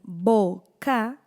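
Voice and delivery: "boca" slowly split as bo, ca; syllable by syllable. Shown as a demonstration. Teacher showing two open syllables, "boca" split bo, ca. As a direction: leave a clear gap inside "boca": bo... ca. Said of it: "Las dos sílabas en".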